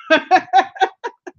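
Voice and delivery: a real laugh that rises at the end; playful rising chuckle; teasing laugh. Real, not acted. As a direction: laugh at her pride. Teasing viewer about her percent.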